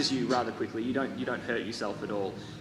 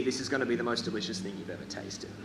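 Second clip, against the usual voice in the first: ordinary delivery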